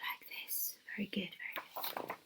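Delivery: soft whispered praise. Real, not acted. Teacher whispering praise to children, whisper. Quiet aside after the sigh.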